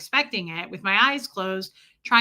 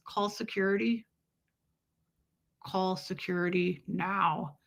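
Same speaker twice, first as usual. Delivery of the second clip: hushed alarm; 6 dB quieter than usual. Retold or imitated. Voicing alarmed onlookers.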